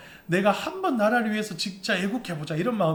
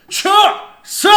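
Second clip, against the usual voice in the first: barked army drill shout, loud and sharp; korean military style; 12 dB louder, slower than usual. Shown as a demonstration. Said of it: "군대식으로 격려를 한번 드리겠습니다". (군대식 구령)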